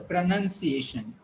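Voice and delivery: slowed "pronunciation" as pruh-nun-see-AY-shun; deliberate joined-up "pronunciation"; model pronunciation. Shown as a demonstration. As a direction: say "pronunciation" carefully with NUN second. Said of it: "how you'll pronounce it".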